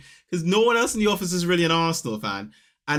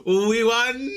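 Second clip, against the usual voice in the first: higher, laughing cheer; gleeful; 6 semitones higher, much slower than usual. Retold or imitated. Fan gloating to his neighbour about the win.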